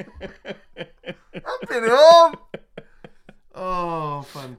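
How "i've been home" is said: very slow, loud broad Yorkshire; Yorkshire accent. Retold or imitated. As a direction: stretch it out, laughing, Yorkshire. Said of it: "In a thick Yorkshire accent".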